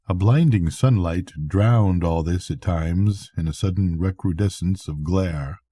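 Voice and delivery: ordinary delivery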